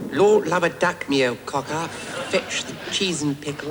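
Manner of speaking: cheeky schoolboy Cockney; mock Cockney. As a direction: ham up Cockney slang for the teacher. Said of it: "translate into Cockney the phrase".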